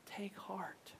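very soft, near-whispered comfort; gentle. Acted. Jesus coming to someone gripped with fear, gentle. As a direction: breathe it out tenderly and quietly. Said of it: "he comes and he says gently".

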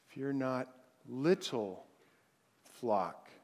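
"little" set apart after a quiet, low "Fear not"; hushed verse with "little" picked out between pauses; stress on little. Shown as a demonstration. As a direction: lean on "little", then drop low on "flock". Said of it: "emphasize the next word".

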